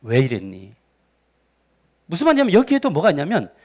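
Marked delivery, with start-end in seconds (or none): 0.0-0.8 low, soft, caring question; gentle God. Acted. God coming to Adam after the fruit, gently. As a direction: ask it quietly, without any anger. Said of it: "저는 아니라고 봐요".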